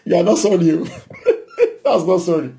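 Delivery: chuckling, amused scolding; laughing rebuke. Real, not acted. Preacher to congregation, laughing as he rejects the fake apology. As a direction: laugh while calling it out. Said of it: "laughing rebuke".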